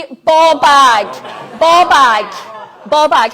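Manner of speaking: loud chant-leading call; loud call-and-response. Shown as a demonstration. Host leading non-Scots guests after one, two, three, loud call. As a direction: belt it out for them to repeat.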